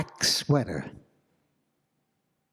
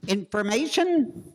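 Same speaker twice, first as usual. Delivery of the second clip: operator voice; 10 semitones higher than usual. Acted. Telephone operator answers the professor's call, high and chirpy. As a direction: sing out like a bored switchboard operator.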